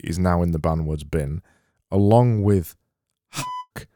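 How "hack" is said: squeaky hack. Shown as a demonstration. Host banning the word hack with a squeaky sound. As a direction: hack squeaked far higher and softer.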